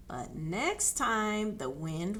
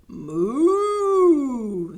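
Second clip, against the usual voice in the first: loud, long, contented moo; happy moo; 5 semitones higher, 13 dB louder than usual. A narrator's reading. Cow happily mooing with her sound back. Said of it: "said Cow happily".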